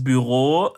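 "Büro" slow, with long final o; French-style Büro. Shown as a demonstration. Southerner explaining the French pronunciation of Büro, French-style. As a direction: draw out "Büro" as the French would. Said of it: "wie sprechen sie Franzosen aus".

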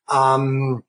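ordinary talk

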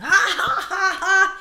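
high, loud mock laughter; gushing fake giggles and hoots; imitated laughing followers. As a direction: imitate an amused crowd in falsetto. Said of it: "meine D Ms voll mit Leuten".

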